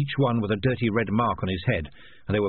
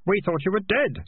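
put-on young boy voice; 9 semitones higher than usual. A narrator's reading. Keith speaks, boy voice.

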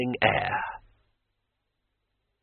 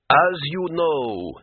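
slow, hesitant official, pitched up; uncertain lawmaster; 4 semitones higher, much slower than usual. A narrator's reading. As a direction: draw it out as an unsure old official. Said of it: "he said uncertainly".

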